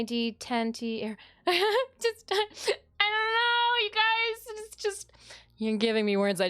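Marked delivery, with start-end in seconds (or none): 1.5-2.0 high giggle; nervous laugh. Real, not acted. Flustered streamer laughs. 2.0-5.1 high, slow exasperated whine; flustered whine. Real, not acted. Flustered at the viewers' demands.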